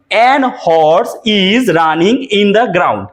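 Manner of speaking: each word of the sentence drawn out very slowly; slow dictation. Shown as a demonstration. Teacher reads the faulty sentence slowly to students. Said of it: "কি error আছে".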